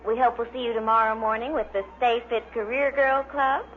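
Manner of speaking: usual speaking voice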